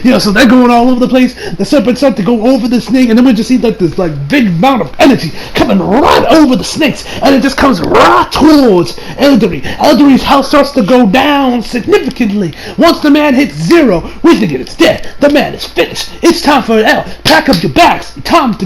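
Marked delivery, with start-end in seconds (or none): none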